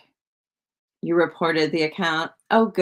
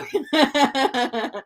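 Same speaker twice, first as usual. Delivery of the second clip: teary laugh; 7 semitones higher than usual. Real, not acted. Laughing off her tears.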